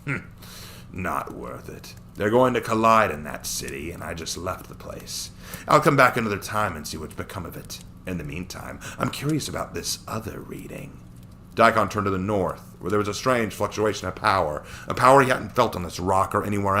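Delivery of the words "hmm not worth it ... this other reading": a cruel Saiyan amused by his prey; sneering villain. Acted. Daikon decides to move on.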